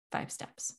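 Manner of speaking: breathy whispered repeat, almost to yourself. Shown as a demonstration. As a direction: echo it back in a whisper. Whispered echo of the recap.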